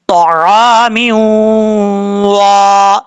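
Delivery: very slow तआमी वा with a nasal hum held; with ghunna. Shown as a demonstration. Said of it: "गुन्ना करेंगे". (गुन्ना के साथ धीमी तिलावत)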